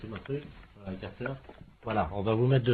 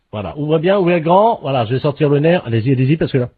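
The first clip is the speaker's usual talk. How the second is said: dentist egging on; 8 semitones higher, 5 dB louder than usual. Acted. Dentist urging during fake operation.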